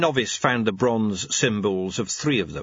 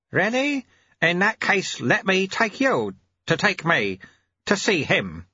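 lightly voiced old sweeper; 9 semitones higher than usual. A narrator's reading.

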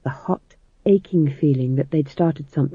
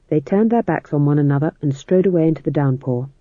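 usual speaking voice

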